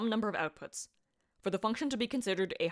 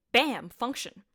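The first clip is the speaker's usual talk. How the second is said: excited bam; 4 semitones higher, 5 dB louder, much slower than usual. Acted. Repeated punchline, bigger this time.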